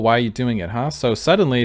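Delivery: usual speaking voice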